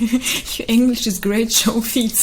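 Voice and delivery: laughing through the words; amused, laughing compliment. Real, not acted.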